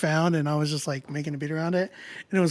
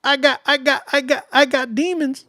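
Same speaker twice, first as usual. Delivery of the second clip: higher, louder, repeating I got like a hype chant; mock hype; 9 semitones higher, 5 dB louder than usual. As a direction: pump it up like a hammed-up rap boast. Mock boast about having demons.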